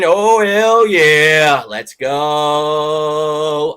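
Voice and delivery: stretched-out hype, loud and high; hyped. Real, not acted. Fan thanks viewers for coming to games, stretched hype cheer. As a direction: cheer it slowly to the fans. Hyped to see fans' team.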